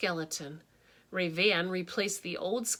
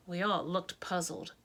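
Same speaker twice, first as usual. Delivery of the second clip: ordinary delivery, nothing marked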